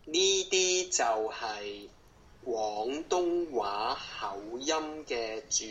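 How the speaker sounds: slow, careful Cantonese demo, syllable by syllable; slow it right down for the learner. Shown as a demonstration. Teacher slows down because the learner asked, slow careful delivery. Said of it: "Can you do it even slower".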